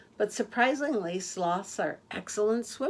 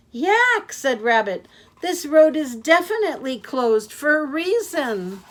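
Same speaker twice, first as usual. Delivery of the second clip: alarmed warning; 7 semitones higher, 9 dB louder than usual. Acted. Rabbit warning again.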